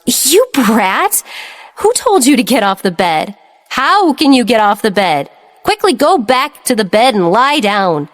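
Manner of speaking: sharp scolding bark. Acted. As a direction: scold him like a bossy sister. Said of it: "suddenly barked".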